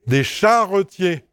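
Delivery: "charretiers" restated as char-re-tier; careful pronunciation. Shown as a demonstration. Teacher correcting after the wrong versions, "charretiers" in three syllables. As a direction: give "charretiers" its full middle schwa. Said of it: "J'ai bien dit charretiers".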